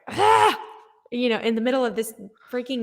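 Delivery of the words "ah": high, loud yell of release. Retold or imitated. Saleswoman reenacting her yell at the sky in a neighborhood, yelled. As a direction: let out a short cathartic yell at the sky. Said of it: "yelled, and I was like".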